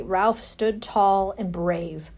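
plain reading voice

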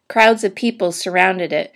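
ordinary delivery, nothing marked

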